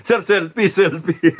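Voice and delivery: high, excited request with laughter; imitating excited fans begging for a selfie; eager fan. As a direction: gush at the celebrity. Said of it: "fans asking for selfie".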